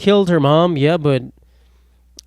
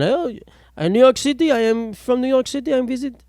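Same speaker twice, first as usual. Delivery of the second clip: much higher, foreign-tourist put-on; mock tourist voice; 10 semitones higher than usual. Pretending to be a tourist from Belgium, high put-on voice. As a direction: play a lost tourist, pitched way up.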